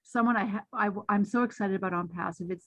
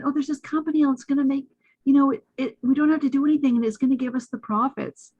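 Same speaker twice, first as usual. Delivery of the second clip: imitating excited friend; 5 semitones higher, 4 dB louder, faster than usual. Friend retold gushing about company.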